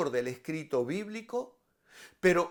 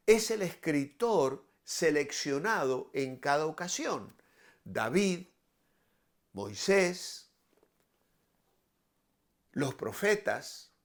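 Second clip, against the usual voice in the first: ordinary talk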